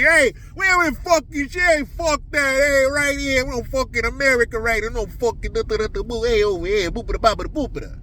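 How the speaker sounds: high, rowdy mock Italian New York voices; Italian New Yorker accent. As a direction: ham up young Italian New York guys yelling. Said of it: "twenty-five-year-old Italian New Yorkers".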